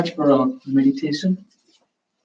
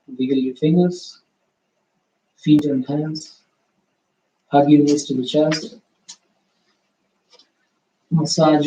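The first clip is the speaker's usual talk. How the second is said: guided relaxation; 3 semitones higher than usual. Acted. Yoga teacher guiding students out of rest, calm and unhurried. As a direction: ease the class gently back into their bodies.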